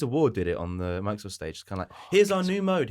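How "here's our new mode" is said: mock presenter. Mimics a company's stage presentation.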